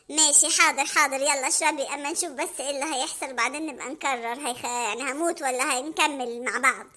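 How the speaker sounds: low, resigned Hamada. Acted. Hamada gloomily waiting to see what happens. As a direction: resign yourself to fate.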